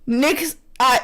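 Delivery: ordinary talk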